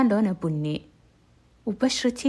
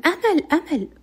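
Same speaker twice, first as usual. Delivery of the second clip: high, loud calling a name; loud call for Amal; 9 semitones higher, 5 dB louder than usual. Retold or imitated. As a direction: call across the house. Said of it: "قام ينادي على أمل".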